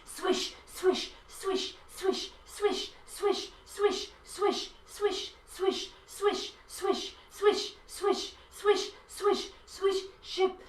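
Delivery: soft, rhythmic swishing noises; playing the washing machine, soft swishes for the kids. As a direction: swish softly like a washer drum turning. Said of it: "let's be the washing machine".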